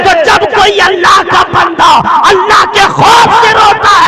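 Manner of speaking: loud, high impassioned sermon building to a roar; full-force preaching. Acted. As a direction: preach it out with fervour, voice climbing. (پرجوش خطابت، بلند اور اونچی آواز میں)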